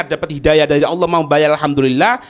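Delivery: normal talking voice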